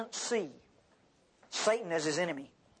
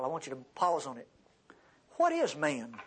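ordinary delivery

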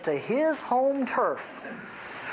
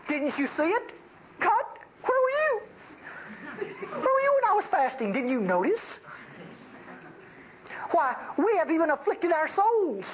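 much higher, whining complaint to God, hammed up; mock whiny religious people; 9 semitones higher than usual. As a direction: whine at God like a self-righteous churchgoer. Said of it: "what the religious people are saying to God".

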